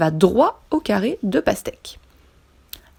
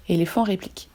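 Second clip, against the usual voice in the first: usual speaking voice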